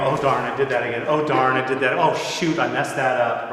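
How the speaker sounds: loud, exasperated self-reproach; acted frustration with oneself; mock self-scolding. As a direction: kick yourself out loud over each slip. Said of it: "and I go".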